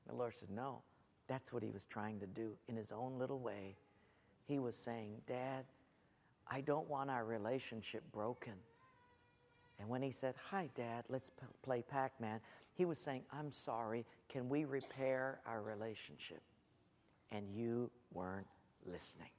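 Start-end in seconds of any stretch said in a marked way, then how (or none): 0.5-8.6 hushed, low, tender explanation; gentle correction. Retold or imitated. The Lord explaining a son's plea, quiet and tender. 10.5-19.3 very soft, low, sombre voice; gentle reproof. Retold or imitated. The Lord showing dad what he missed, quiet reproach.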